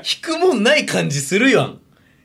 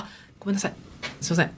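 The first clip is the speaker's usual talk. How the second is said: very soft, acted apology; meek apology; 12 dB quieter, much faster than usual. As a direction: back down softly with an apology. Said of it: "みたいな人の場合には".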